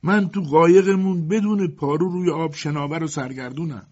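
high, loud plaintive wail; wailing shout. A narrator's reading. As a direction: cry out woefully for rescue. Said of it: "او با ناله فریاد زد". (فریاد همراه با ناله)

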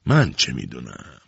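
low, slow groaned reply; moaning. A narrator's reading. Mash Ebram fends off Nowruz's questioning, moaning. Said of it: "مش ابرام نالید".

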